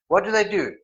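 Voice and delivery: ordinary talk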